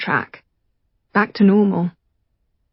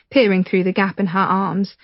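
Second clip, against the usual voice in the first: ordinary talk, nothing marked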